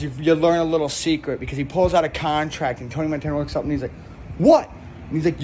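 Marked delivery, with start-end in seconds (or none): 4.4-4.7 high loud startled what; mock surprise. Tony hearing the contract, a high loud startled cry. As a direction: blurt it out in disbelief. Tony's baffled reaction.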